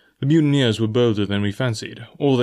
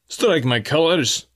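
indignant cry; 5 semitones higher, slower than usual. Acted. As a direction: spit it out with indignation. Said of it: "cried the captain".

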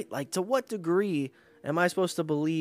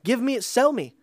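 mock pushy pitchman, higher and louder; mocking the caller's pitch; 8 semitones higher, 5 dB louder than usual. Mocking a troll caller's voicemails, in a loud, high, pushy voice. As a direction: parrot his sales pitch back with scorn. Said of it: "you're like".

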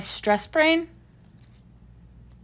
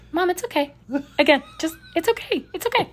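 daughter comforting; 7 semitones higher than usual. Retold or imitated. Daughter soothing her crying mom over FaceTime, lightly voiced and higher.

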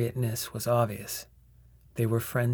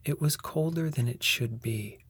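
ordinary delivery, nothing marked

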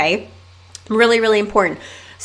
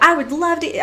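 mock excited caller; 8 semitones higher than usual. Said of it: "she was so excited".

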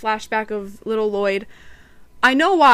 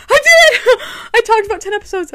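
real whoop of joy, much higher and louder; triumphant cheer; 11 semitones higher, 10 dB louder, faster than usual. Real, not acted. Reviewer finishing her last episode, cheering loudly. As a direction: celebrate like you just won.

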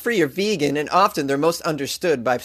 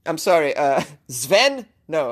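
usual speaking voice

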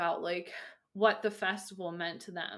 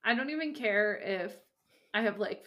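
ordinary talk, nothing marked

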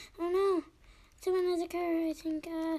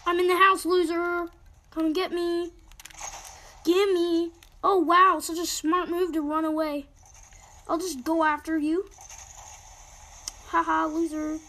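taunting; 3 semitones higher, 9 dB louder than usual. Real, not acted. Taunting opponent in game.

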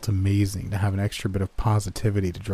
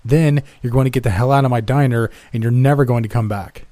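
angry boss; 3 semitones higher, 7 dB louder than usual. Acted. Boss firing him in anger.

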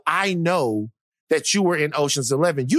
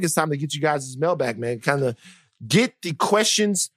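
ordinary talk

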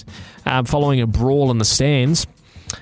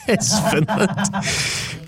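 laughing it out slowly; 9 semitones higher, much slower than usual. Real, not acted. Host cracking up at his own story, laughing. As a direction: repeat it through laughter. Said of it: "laughing repeat".

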